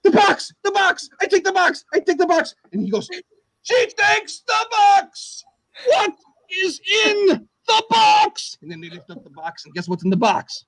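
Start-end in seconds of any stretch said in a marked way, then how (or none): 0.0-2.5 high, eager contestant voice; lightly hammed-up girl picking the box. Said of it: "And then the girl goes". 3.6-8.6 very high, slow, wailing shout; game show host impression. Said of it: "That's my impression".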